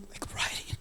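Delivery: whispered aside; hushed aside. Real, not acted. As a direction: drop to a whisper. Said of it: "whispered aside".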